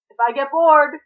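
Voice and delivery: loud, high call; teasing friend calling after Tim, loud. A narrator's reading. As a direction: call after him down the hall. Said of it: "Con called".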